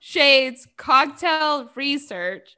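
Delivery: laughing, drawn-out mock importance; amused emphasis. Real, not acted. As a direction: spell it out slowly while fighting laughter. Mock-serious research claim, co-host laughing.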